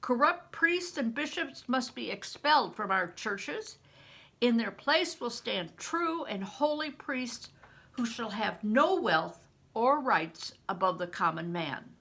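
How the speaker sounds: loud, high preaching voice; a priest rallying rebels, preaching at full force; rousing sermon. Acted. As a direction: preach it to the crowd with fire. John Ball preaching to the men.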